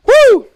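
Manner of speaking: sudden high-pitched whoop; high woo shout. Real, not acted. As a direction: yelp from the heat. Reacting to hot sauce.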